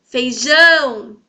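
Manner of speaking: Feijão, last syllable long and loud; slow demo of feiJÃO, final syllable swelling; stress on JÃO. Shown as a demonstration. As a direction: stretch out and push the JÃO in Feijão. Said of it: "O jão, então, é a sílaba tônica".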